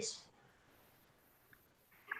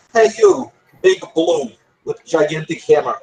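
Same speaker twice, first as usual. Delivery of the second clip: calling out; 7 semitones higher than usual. Acted. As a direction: call across to get the giant's attention. Calls out to the troll.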